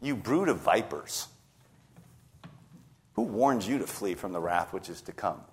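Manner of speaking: low, very soft, understated rebuke; John the Baptist. Acted. Preacher quotes a prophet rebuking those awaiting baptism, hushed and low. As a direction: drop the voice low and quiet for a grave warning. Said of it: "John the Baptist turns to them and says".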